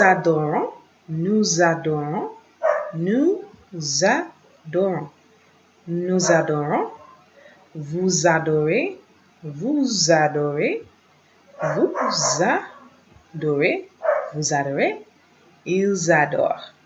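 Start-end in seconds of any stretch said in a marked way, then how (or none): 2.9-5.1 "Nous adorons" broken into slow, separate pieces; spaced-out teaching pronunciation; syllable by syllable. Shown as a demonstration. Syllable-by-syllable model for learners. 11.6-13.9 "Vous adorez" very slow, pulled apart; slow, separated teaching pronunciation; syllable by syllable. Shown as a demonstration. Slow model for learners.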